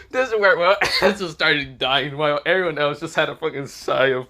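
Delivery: talking through laughter; can't stop laughing while telling. Real, not acted. Storyteller laughs at joke.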